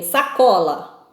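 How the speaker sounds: "sacola" slowly, leaning on the middle "co"; "sacola" drawn out with a strong middle syllable; stress on second-to-last syllable. Shown as a demonstration. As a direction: stretch and punch the CO in "sacola". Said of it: "a penúltima sílaba é a tônica".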